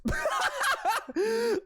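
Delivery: a high, helpless laugh; high burst of laughter. Real, not acted. Streamer laughing hard at her own pun. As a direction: crack up at your own joke.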